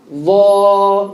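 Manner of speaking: Va. drawn out like a chant, emphatic; heavy Arabic letter. Shown as a demonstration. Teacher chanting the heavy letter to the class. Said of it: "heavy letter".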